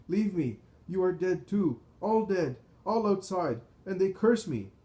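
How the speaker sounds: high, loud outbursts in short bursts; frantic raving. A narrator's reading. Maddened man to Joseph who grabbed him, frantic. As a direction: cry out in mad panic.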